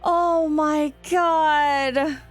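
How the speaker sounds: drawn-out awe. Real, not acted. Streamer reacting to plot twist.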